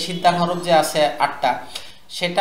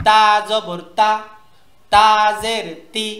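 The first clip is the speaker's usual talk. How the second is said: slow spelling chant, lingering on the first "তা"; slow, level letter-by-letter recitation; drawn-out spelling; 3 semitones higher, 4 dB louder, slower than usual. Shown as a demonstration. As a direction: stretch "তা জবর", then land on the short syllable. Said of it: "বানানোর সময় ঝুল দিব".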